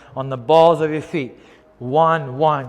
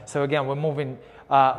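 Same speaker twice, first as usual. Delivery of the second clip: ordinary delivery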